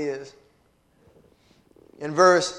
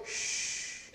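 long, soft shushing hiss; hushing shh; 15 dB quieter than usual. Retold or imitated. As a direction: hush someone who talks too much. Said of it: "And when he told me".

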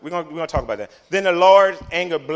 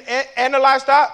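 higher, louder mock indignation; mock outrage; 9 semitones higher, 8 dB louder than usual. Preacher mimics the grumbling Israelites, mock outrage. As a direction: echo the complaint in exaggerated disbelief. Mocking the complainers' retold question.

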